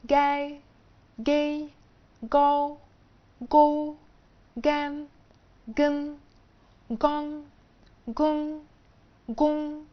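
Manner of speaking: each syllable said slow, high and clear, one by one; pronunciation demo, syllable by syllable; syllable drill. Shown as a demonstration. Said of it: "بعد كده حرف الجي هينطق".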